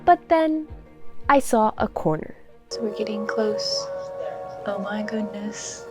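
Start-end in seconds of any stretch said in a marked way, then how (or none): none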